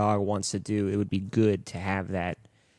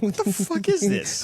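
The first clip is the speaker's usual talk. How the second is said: mock outrage; 9 semitones higher than usual. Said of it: "you're like".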